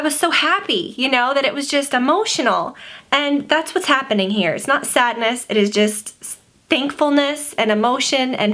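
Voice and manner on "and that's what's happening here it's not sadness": ordinary talk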